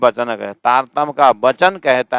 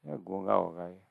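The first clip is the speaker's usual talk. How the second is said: a low, soft aside from the traveller; muttered dismissal; 5 semitones lower, 15 dB quieter than usual. Retold or imitated. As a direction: grumble it under your breath. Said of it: "करके चला गया". (राहगीर का धीमा बड़बड़ाना)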